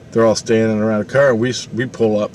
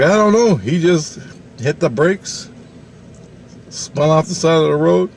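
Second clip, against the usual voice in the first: re-enacted bewilderment, pitched up; flustered aunt; 6 semitones higher than usual. Aunt telling dad about the sudden braking, flustered. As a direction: play the rattled aunt giving her account. Aunt's flustered answer, acted out.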